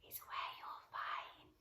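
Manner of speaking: whispered, leading to a surprise; suspense whisper. Acted. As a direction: hush it like a secret for the kids. Teacher building suspense for children.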